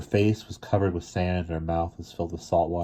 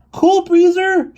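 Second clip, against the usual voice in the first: very high, loud, slow plea; pleading; 11 dB louder, much slower than usual. Acted. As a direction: call his name begging. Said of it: "she pleaded".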